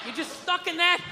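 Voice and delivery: ordinary talk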